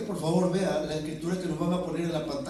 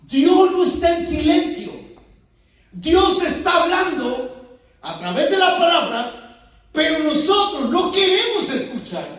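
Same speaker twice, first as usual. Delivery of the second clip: higher and louder, slow and declaiming; preacher insisting God is speaking, loud and fervent; full-force preaching; 9 semitones higher, 9 dB louder than usual. Acted. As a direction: thunder it from the pulpit.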